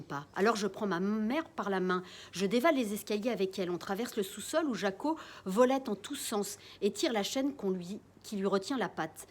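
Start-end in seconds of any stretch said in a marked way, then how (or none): none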